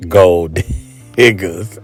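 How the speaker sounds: ordinary talk, nothing marked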